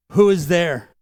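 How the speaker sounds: loud challenge from behind a closed door; calling out. Retold or imitated. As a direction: call out to whoever is knocking. Voice inside crypt challenges the knocker.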